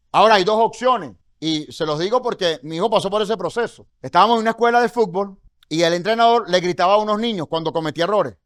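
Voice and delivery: usual speaking voice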